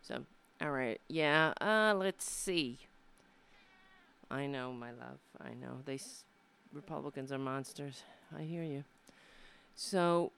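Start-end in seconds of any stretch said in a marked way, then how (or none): 4.3-8.8 soft, low, tender murmur; soothing the pet. Real, not acted. Host softly soothing her pet while agreeing with him. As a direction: comfort him quietly.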